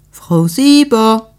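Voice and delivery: calling out to be heard through the door; impatient drawn-out call through the door; insistent call. Acted. Neighbour calls at the door.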